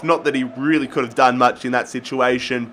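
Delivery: ordinary delivery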